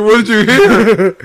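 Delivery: high, loud, stretched-out yell; mock outraged outburst. Cohost acting out a reaction to "friend", mock outraged yell. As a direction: burst out in a hammed-up high shout.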